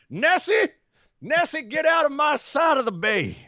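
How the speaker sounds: high, hammed-up Southern yell at Nessie; Southern accent. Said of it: "the Scottish are all of a sudden now Southerners".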